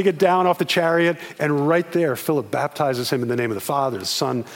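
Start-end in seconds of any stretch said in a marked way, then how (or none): none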